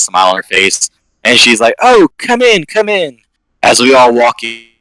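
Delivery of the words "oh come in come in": high, sing-out cheery greeting; delighted welcome. Acted. Beaming professor inviting visitors into the lab, delighted. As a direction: usher them in, pitched way up with delight.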